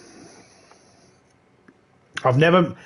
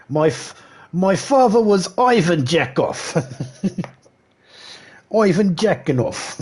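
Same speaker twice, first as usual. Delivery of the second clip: slow, higher hammed-up Russian accent through laughter; bad Russian accent; 7 semitones higher, slower than usual. Streamer keeps up the joke Russian voice, cracking up. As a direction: ham up a Russian boasting of his father, laughing. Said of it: "that very, very bad accent".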